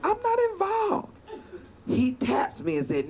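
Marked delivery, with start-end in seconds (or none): 0.0-1.1 high-pitched nervous protest; hands-off, high-voiced panic; panicky denial. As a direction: squeak out that you're not involved.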